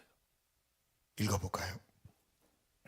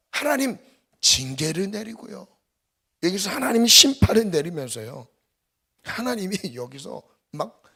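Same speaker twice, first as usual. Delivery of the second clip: ordinary delivery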